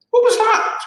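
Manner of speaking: sudden high, loud cry of alarm; startled cry. A narrator's reading. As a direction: yelp it out in shock. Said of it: "cried Missus Twit".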